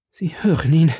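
trembling voice. A narrator's reading. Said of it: "rief Milady mit zitternder Stimme".

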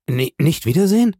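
stockend und deutlich höher, steigende Frage; bestürztes Echo. A narrator's reading. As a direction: entsetzt nachfragen, Frauenstimme. Julie weint, wiederholt die Frage. (hohes ungläubiges Stammeln)

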